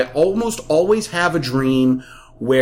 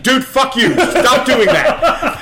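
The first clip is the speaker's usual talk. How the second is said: higher, louder mock yell, slowed at the end; annoyed yell; 9 semitones higher, 4 dB louder, slower than usual. Retelling yelling at his friend over the CB, mock annoyed yell. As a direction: snap back at the prankster, half laughing. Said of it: "be like".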